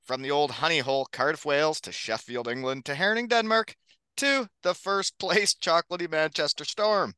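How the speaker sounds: big hype podcast announcer read; announcer intro. Acted. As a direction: introduce the guests like a showman. Host reads hype podcast intro.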